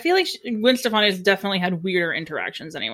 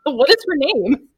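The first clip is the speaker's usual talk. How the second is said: louder, slower, put-on voice; exasperated outburst; 4 semitones higher, 6 dB louder, slower than usual. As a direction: grab the room, mock-outraged. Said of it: "That's pretty good".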